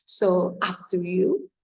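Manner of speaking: normal talking voice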